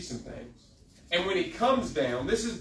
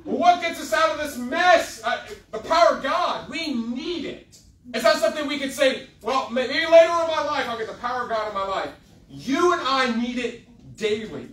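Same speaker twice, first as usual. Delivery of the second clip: raised, higher-pitched preacher's cry; fervent preaching; 7 semitones higher, 6 dB louder than usual. Real, not acted. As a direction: drive it home loudly to the congregation.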